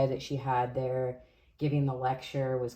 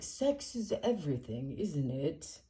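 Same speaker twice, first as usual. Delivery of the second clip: bad British impersonation; 2 semitones higher, 5 dB quieter than usual. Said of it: "that was a really bad impersonation of him".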